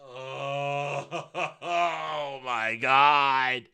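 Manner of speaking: low, drawn-out laugh into the words; laughing in disbelief. Real, not acted. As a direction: groan it out through a laugh. Laughs at the cheesy scene.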